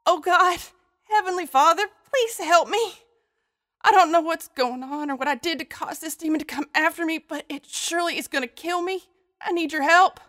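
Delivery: high, frightened woman praying fast; desperate prayer. Acted. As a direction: pray for rescue in terror. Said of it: "began to pray with all her might".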